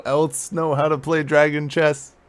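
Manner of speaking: indignant, projecting question; exasperated appeal. Acted. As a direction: appeal to the whole room. Player appeals to onlookers in quarrel.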